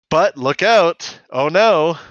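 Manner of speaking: loud, high, drawn-out mock warning; mock alarm. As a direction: ham up the sudden danger. Narrator's mock alarm in recap.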